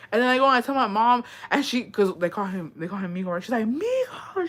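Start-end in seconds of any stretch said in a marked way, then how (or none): 3.6-4.5 mom calling out. Retold or imitated.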